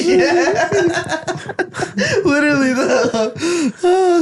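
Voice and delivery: high, speaking through real laughter; laughing. Real, not acted. Cracking up at the nationality story, laughing. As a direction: barely get it out through laughter.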